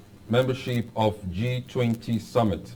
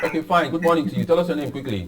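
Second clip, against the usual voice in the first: to someone hard of hearing; 7 semitones higher, 4 dB louder, faster than usual. Real, not acted. Host calls to faint caller.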